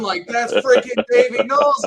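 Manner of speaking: high, loud, starstruck outburst; internal fangirl scream. Retold or imitated. Fan seeing his guitar idol standing there, screaming inside. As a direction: scream it inside like a little girl. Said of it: "I'm screaming like a little".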